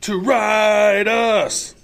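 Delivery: slow, loud shout; shouted on cue. Pretend. Co-host answers the host's prompt with a slow shout. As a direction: bellow the punchline slowly, stretching each word. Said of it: "Say it".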